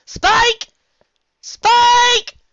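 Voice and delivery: high, loud calls for Spike; shouting. A narrator's reading. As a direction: yell across the house for him. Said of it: "Twilight shouted from bed".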